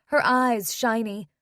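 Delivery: usual speaking voice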